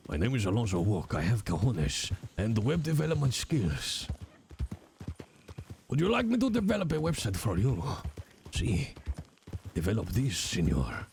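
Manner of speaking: lightly hammed Spanish accent, a suave salesman; mock Spanish accent. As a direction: pitch the website like a smooth Spanish salesman. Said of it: "weird tour of accents".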